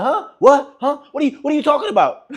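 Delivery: high, quick mock bafflement; confused and riled. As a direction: imitate a thrown woman firing questions. Imitating the confused, riled-up woman.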